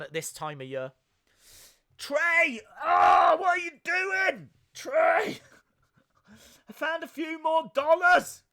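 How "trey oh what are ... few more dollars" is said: shouting. Real, not acted. Viewer donation alert clip.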